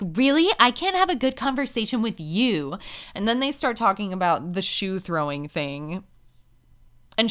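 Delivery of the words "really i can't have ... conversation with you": mocking Darcy. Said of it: "And she's like".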